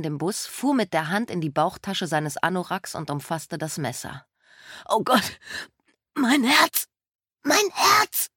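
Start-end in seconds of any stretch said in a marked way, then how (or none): 4.9-8.3 gasping, rising higher in panic; gasping for air. Acted. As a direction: fake heart attack, panting and crying out. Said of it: "keuchte er".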